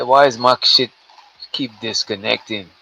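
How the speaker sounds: ordinary delivery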